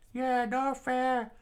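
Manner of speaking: slow, very high falsetto complaint; high-pitched protest from a friend; screamed out. Acted. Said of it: "her friend screamed out".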